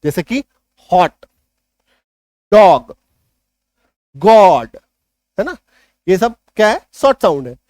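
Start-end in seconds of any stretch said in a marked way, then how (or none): 0.9-4.7 हॉट, डॉग, गॉड with open "aw", one by one; हॉट, डॉग, गॉड as isolated, punched examples; short O examples. Shown as a demonstration. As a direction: model each word loudly, long pauses between. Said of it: "ओ का sound ओ".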